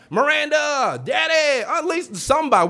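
high desperate yelling for mama; hollering for mama. Retold or imitated. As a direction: scream for your mama like you're about to die. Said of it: "I'm be hollering for everybody".

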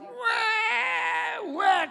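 high wailing baby cry imitation; mock baby wail. Preacher imitating his crying newborn son, high mock wail. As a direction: wail like a newborn wanting milk.

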